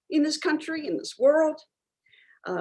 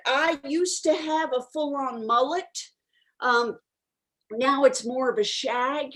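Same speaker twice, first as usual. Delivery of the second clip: normal talking voice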